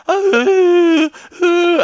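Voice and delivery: high, loud mock whimper of a cracked voice; faked voice crack. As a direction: whine like a choirboy hiding his voice. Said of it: "I had to fake that my voice had broken".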